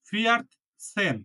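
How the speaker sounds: ফিয়াট সেন said slowly, t sounded clearly; careful pronunciation. Shown as a demonstration. Teacher modelling fourteen in German, careful pronunciation. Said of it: "ছ দিয়ে উচ্চারণ করছি".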